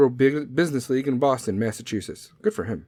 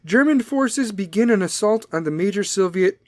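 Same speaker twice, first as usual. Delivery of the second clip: ordinary talk, nothing marked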